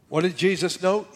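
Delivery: normal talking voice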